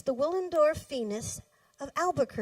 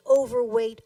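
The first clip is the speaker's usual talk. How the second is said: ordinary delivery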